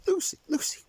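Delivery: alarmed call. Acted.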